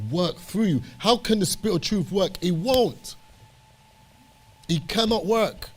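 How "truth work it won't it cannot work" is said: impassioned preaching. Real, not acted. Preacher holding Moses up to the congregation, impassioned. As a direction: preach it with mounting conviction.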